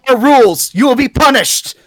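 loud, booming mock-strict host laying down the law; hammed-up stern decree to a guest breaking the rules; mock stern judge. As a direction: play the pompous rule enforcer, hammed-up. Host mock-scolds rule breaker.

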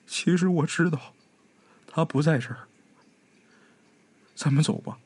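put-on hushed girl after crying; resigned sigh. A narrator's reading. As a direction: give up gently, let it go. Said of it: "叹了一口气".

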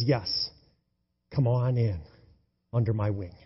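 soft, low and gentle; tender welcome. Acted. Preacher voicing the Lord welcoming believers, soft and tender. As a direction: invite them in like a sheltering parent.

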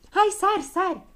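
high, loud goading; high-pitched, insistent storybook command; urgent urging. A narrator's reading. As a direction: egg someone on to jump. Urging a companion to jump.

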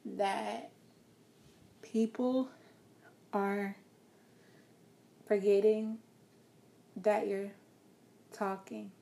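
very slow with long pauses between words; overly slow demo; too slow. Shown as a demonstration. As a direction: drag each word out with gaps. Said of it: "a pace that's so slow".

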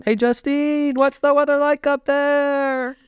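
high, slow, calling up voice; high silly voice. Pretend. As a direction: call way up high. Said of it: "my giraffe voice".

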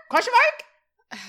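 very high, loud, drawn-out rising query; incredulous question. Real, not acted. Recapper doubting the episode is over, incredulous. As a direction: squeak it in comic disbelief.

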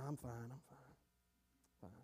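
very low, barely audible reply; muted brush-off. Acted. Downcast churchgoer answering a greeting, muted brush-off. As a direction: murmur it softly, brushing the question away. Churchgoer covering up his misery.

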